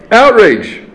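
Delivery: Outrage punched high and loud; Outrage as one sharp loud declaration; emphatic outrage. Shown as a demonstration. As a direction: fire off Outrage like a cry of protest.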